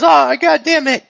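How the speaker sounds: high, loud burst of exasperation; mock-pained cursing after losing a metal arm; annoyed outcry. Customer's arm shot off.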